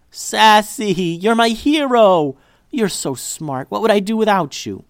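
high, loud, slowed gushing thanks; overjoyed praise. Acted. Liddy gushing over her dog for finding the necklace. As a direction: gush over the dog in relief.

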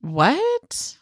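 high rising "what?"; acted disbelief, pitched right up; astonished. Co-host reacts to undeniable ghost voice, astonished.

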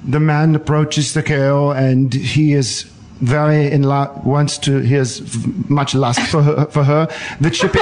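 put-on German-accented porno narrator, measured and deliberate; Werner Herzog. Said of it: "he sounded a little like Werner Herzog".

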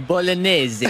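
lightly mock Italian Bolognese; Italian accent. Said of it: "it is like".

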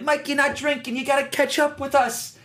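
goading friends voice. Retold or imitated. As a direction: egg him on to drink. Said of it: "why is that their voice".

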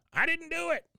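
very high put-on protest; hammed-up cry of innocence; Cotton. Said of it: "you hear Cotton's go".

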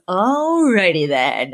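slow, drawn-out shrug of a line; deflated acceptance; resigned. Retold or imitated. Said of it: "she just goes".

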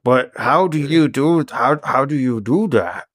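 halting, slow character voice; slow talker. Acted. Grendon puzzles over the fast talker, slow and halting. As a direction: labour slowly through the question. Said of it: "It hurts when I talk, so I talk real slow".

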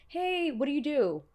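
high-pitched mock-friendly chatter; eager networker. LA event-goer sizing up a new creator, mock-eager. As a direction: put on a high, gushy networking voice. Acting out LA networking small talk.

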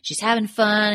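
ordinary talk, nothing marked

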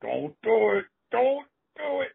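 high-pitched escalating plea; mounting alarm. Retold or imitated. Wrestler begging opponent not to hit, high mock panic. Warning escalates before the blow.

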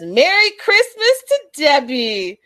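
high, loud, drawn-out holiday cheer; mock jubilant greeting. Vlogger retelling a festive greeting for Debbie, mock jubilant. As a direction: belt it out like an exaggerated merry greeting.

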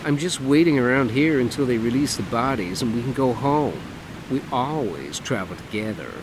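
camp it up, lilting and showy; flamboyant man. Acted. Dougie voiced in story.

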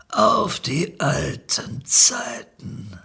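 sehr langsam gedehnte, hohe Dealerstimme; abgewrackter Dealer. A narrator's reading. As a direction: jedes Wort genüsslich in die Länge ziehen. Dealer überreicht das Tütchen. (verlebter Dealer, extrem schleppend)

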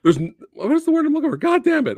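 high, loud, emotional outburst; frustrated, overcome while hunting for a word; getting emotional. Real, not acted. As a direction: voice jumping up as emotion gets the better of you. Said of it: "try not to get emotional".

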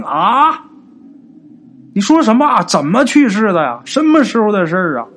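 high, loud stunned questions; shocked. Acted. Father hears his brother died, shocked and loud. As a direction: blurt out in disbelief. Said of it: "大为惊讶".

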